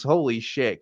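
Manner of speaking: usual speaking voice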